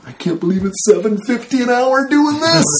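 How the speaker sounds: high, thrilled disbelief; delighted. Retold or imitated. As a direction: squeal with giddy joy. Delighted inner thought retold.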